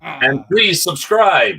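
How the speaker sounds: slow, high announcer plea. Pretend. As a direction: draw out please like a host. Joins the plug.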